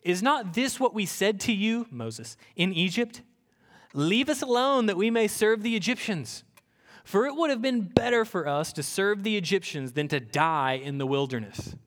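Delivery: lifted public Bible reading; scripture reading. Acted. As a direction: read the complaint with weight.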